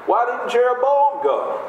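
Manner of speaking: normal talking voice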